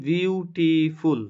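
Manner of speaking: বিউটিফুল slowly stretched into three beats, বিউ-টি-ফুল; syllable by syllable. Shown as a demonstration. Teacher showing students three syllables, বিউটিফুল drawn out part by part. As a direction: sound out বিউটিফুল in three separate parts. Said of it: "তিনবারে উচ্চারণ করতেছি".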